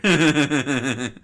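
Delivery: word blurted through loud laughter; helpless loud laugh. Real, not acted. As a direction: crack up mid-sentence. Cracks up at own voices.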